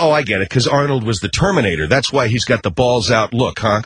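ordinary talk, nothing marked

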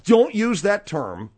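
stern objection. Acted. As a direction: lay it down word by word, exasperated. Scolds the other in quarrel.

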